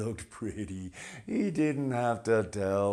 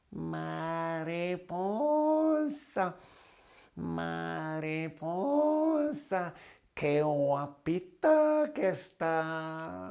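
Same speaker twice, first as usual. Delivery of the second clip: cat voice; 7 semitones higher than usual. Acted. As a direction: purr it up high as a cat. Cat wooing butterfly.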